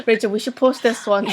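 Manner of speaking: said through giggles; giggling suggestion; laughing. Real, not acted. Laughing during reading.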